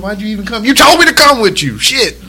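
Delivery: high-pitched mock indignation, rising as it goes; exasperated bickering. Bored husband and wife bickering in store, mock indignant. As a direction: build to a high protest.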